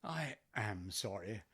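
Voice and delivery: low, slow, soft sympathy; solemn condolence. Acted. Client consoling widow on her loss, solemn. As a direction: offer condolences gravely and slowly.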